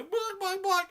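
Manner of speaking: high-pitched mocking chicken squawk; quick high bawk-bawk taunt; chicken clucking. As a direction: cluck like a chicken to tease a coward.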